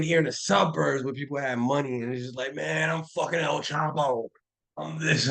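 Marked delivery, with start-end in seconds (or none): 2.5-5.3 mock tough guy. Mocking suburban fake gangsters.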